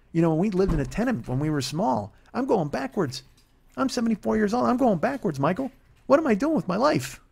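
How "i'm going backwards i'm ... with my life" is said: put-on high, lamenting old-mom voice; imitating his mom. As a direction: whine it like a fed-up elderly mother. Mom voiced in retold phone call.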